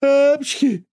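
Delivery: huge high loud sneeze; booming cartoon sneeze; thunderous sneeze. A narrator's reading. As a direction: sneeze so the house shakes. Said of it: "сообщила она".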